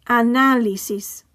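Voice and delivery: Análisis slowly, with NÁ stressed; stress on accented syllable. Shown as a demonstration. Teacher modelling written accents, Análisis stressed on NÁ. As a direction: say Análisis in Spanish, a-NÁ-li-sis. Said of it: "stress the syllable that the accent is on".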